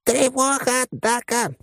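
very high silly alarm voice; crazed loudspeaker alarm. A narrator's reading. Loudspeaker suddenly warns the crew of attack, crazed voice. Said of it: "вдруг заорал динамик дурным голосом".

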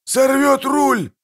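very high, drawn-out yell; bellowing. A narrator's reading. Captain fearing the monster breaks the rudder, bellowing. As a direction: yell in alarm at full pitch. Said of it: "орал Пудлорак".

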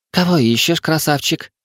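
high-pitched purring female voice; flirtatious purr. Acted. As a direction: purr it to a handsome stranger. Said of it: "промурлыкала высокая девушка". (игривое мурлыканье высоким голосом)

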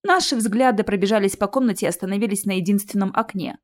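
ordinary delivery, nothing marked

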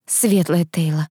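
slow, understated shock; stunned exclamation. A narrator's reading. Heroine hearing of his mother's death, mildly stunned. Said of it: "воскликнула я ошарашенно".

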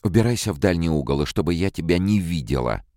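high, loud, fast yell to drive him off; shrill shout. A narrator's reading. Calvin screaming at the robot to get away. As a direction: shriek him away into the corner. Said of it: "пронзительно крикнула".

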